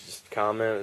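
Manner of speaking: usual speaking voice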